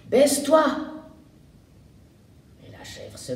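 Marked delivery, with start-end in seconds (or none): 0.1-0.6 higher, louder call to duck; girl warns the goat girl of the water pistol; shouted warning. Acted. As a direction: yell it fast so she ducks in time. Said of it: "lui crie".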